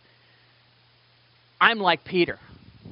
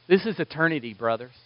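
ordinary delivery

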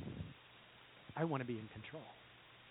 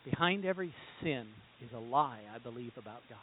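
quiet emphasis; 9 semitones lower, 13 dB quieter than usual. Shown as a demonstration. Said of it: "Think about that".

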